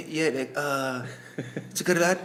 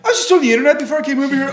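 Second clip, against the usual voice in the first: mocking friend; 8 semitones higher, 8 dB louder, much faster than usual. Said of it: "every time we get together".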